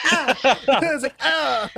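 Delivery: high-pitched mock yelp of pain; hammed-up "ah" of pain. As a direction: yelp as if slapped on a sore back. GM voices man patted hard.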